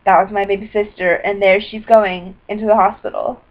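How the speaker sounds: normal talking voice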